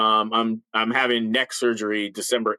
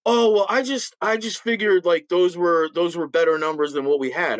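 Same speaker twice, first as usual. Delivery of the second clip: high, hesitant mock excuse; sheepish excuse; 6 semitones higher than usual. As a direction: imitate a bumbling boss's excuse. Mimicking the major's excuse.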